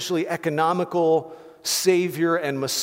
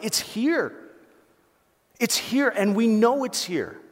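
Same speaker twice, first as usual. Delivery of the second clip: higher-pitched, excited exclamation; freaking out; 6 semitones higher than usual. Acted. Preacher voicing people overjoyed at the Savior's arrival, excited. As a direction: act out overjoyed news breaking. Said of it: "they're just freaking out".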